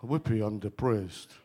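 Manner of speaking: deadpan the whoopee, no joy at all; flat mock cheer. Shown as a demonstration. Preacher showing the flat way to say it, deadpan. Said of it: "you wouldn't say it like that".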